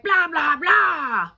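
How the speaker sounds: a hammed-up partner yelling over you; imitating the bully shouting you down; mock shouting back. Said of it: "then they're like".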